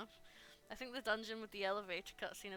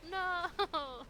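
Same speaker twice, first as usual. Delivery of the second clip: sudden loud "No!"; 7 semitones higher, 6 dB louder than usual. Real, not acted. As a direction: shout it out in dismay. Mock dismay at missed voice.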